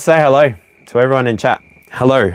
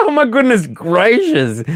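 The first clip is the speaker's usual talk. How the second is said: high laughing exclamation; giggling oh my goodness; 13 semitones higher than usual. Real, not acted. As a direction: laugh it out, delighted. Laughing at the card.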